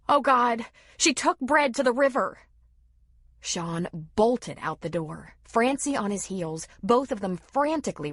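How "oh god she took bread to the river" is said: high, loud alarmed cry; frantic, fearing for her child; panicked. A narrator's reading. Mother crying out that her girl went to the river.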